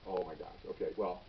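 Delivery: low, soft resigned reaction; hushed dismay. Retold or imitated. Supervisor absorbing his drunk officers' confession, hushed dismay. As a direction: say it under your voice, taking it in.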